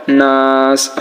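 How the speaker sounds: "নাস" with the a held long; long-vowelled "নাস", no final vowel; three-alif elongation. Shown as a demonstration. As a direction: stretch "নাস" and stop on a bare s. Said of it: "তিন আলিফ টান হবে".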